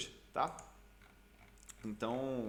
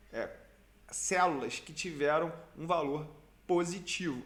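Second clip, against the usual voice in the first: ordinary talk, nothing marked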